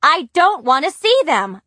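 high, loud furious yell; shouting. A narrator's reading. Girl shouts at parents about the grandparents' visit. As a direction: scream it at the parents. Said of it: "I shouted".

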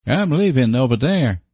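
high-pitched rustic suitor, lightly voiced; abrupt. A narrator's reading. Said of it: "he said abruptly".

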